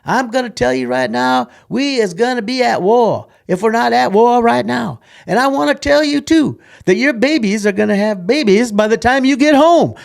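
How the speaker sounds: booming high drill sergeant bark. Retold or imitated. Drill sergeant booming at new recruits, loud and high. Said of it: "that same old rugged, rugged stuff".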